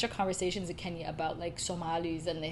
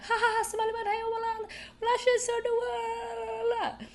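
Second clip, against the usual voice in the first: put-on much higher, cheery old-man voice with a chuckle; friendly old man. Imitating the kind old man greeting her at the border, high and cheery. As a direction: play the beaming old uncle welcoming her.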